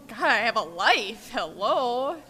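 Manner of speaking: loud, indignant outburst; recovering drinker mock-protesting to God, loud and indignant; exasperated protest. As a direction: throw up your hands at God.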